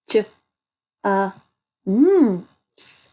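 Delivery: j, uh, mps as separate sounds with gaps; sounding out. Shown as a demonstration. Teacher helping children read the word jumps, sounding it out. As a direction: break it into j-, uh, mps, one sound at a time.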